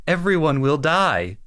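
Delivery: imitated catboy, high-pitched proclamation; Camille impression. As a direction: raise the voice like a catboy making a prediction. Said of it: "is Camille going".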